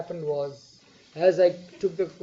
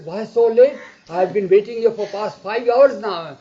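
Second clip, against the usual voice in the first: scolding; 4 semitones higher, 11 dB louder than usual. Retold or imitated. Baba scolding late arrivals.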